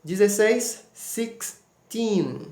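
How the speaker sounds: sixteen with a long ee held into the n; long teen sound. Shown as a demonstration. Teacher models the long teen vowel for students. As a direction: stretch the ee in sixteen. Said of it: "Observe aí, ó".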